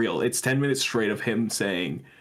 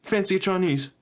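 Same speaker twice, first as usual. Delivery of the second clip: light mock British accent, higher; tossed-off British accent, a touch higher; 6 semitones higher than usual. [name] mocks the senator sounding British, British accent. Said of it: "he says it like a British person".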